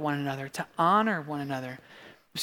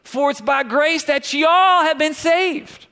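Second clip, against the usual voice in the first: high, slow, loud Southern drawl; Southern lady; 9 semitones higher, 6 dB louder, slower than usual. Acted. Pastor reads Ephesians to the congregation, Southern lady accent. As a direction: proclaim it slowly in a Southern lady's accent. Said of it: "like a nice Southern lady saying y'all".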